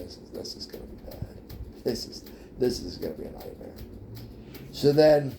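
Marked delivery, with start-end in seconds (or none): 0.0-3.7 hushed, dreading the coming disaster; dreading whisper. Retold or imitated. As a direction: whisper it like a nervous aside. Dreading mom meeting his date.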